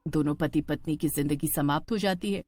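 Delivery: usual speaking voice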